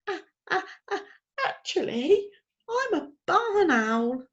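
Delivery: high, out-of-breath owlet stammer; gasping after the fall; breathless stammer. A narrator's reading. Plop out of breath after crashing down, high stammer. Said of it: "when he had got his breath back".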